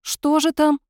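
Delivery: high, loud, gullible victim; naive victim voice. Acted. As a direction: play the dupe walking into a trap. Nikolas voices the foolish victim.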